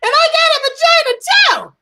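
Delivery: put-on loud, high child blurting it out; high kid voice. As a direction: squeal it like an excited little girl. Kid's line in church lobby.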